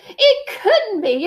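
high, slow, disbelieving splutter; sputtering. Acted. King staring at his noses, high sputtering. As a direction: keep spluttering in shock. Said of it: "the king sputtered".

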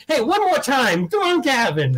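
straining to pump up the crowd, slow; a weak try at a big announcer intro; forced hype intro. Retold or imitated. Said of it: "I tried as best I could".

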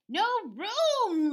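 loud, high cartoon lament; Roly Pig. Pig complains the truck is full, loud high lament. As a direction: wail it like a put-out piglet. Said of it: "lamented Roly Pig".

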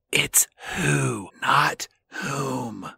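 low, unvoiced whisper in his ear; whispered correction. Little girl whispering a correction to [name], low whisper. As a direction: lean in and whisper it slowly. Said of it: "leans in and goes".

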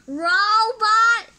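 loud, high angry shout; a furious king's roar, high and loud; furious yell. Acted. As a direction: bellow for the servant. Slug King wakes grouchy.

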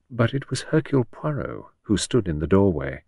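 ordinary delivery, nothing marked

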